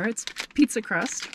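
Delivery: normal talking voice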